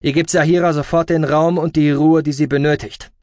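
forceful, measured authority; firm command. Acted. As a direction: lay down the law to both. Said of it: "aber bestimmter Stimme".

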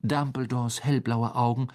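plain reading voice

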